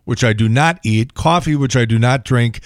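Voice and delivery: ordinary talk, nothing marked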